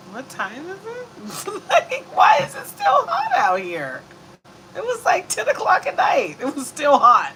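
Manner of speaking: high, loud, slowed disbelief; exasperated heat rant. Retold or imitated. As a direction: wail about the heat with a laugh. Complaining about night heat.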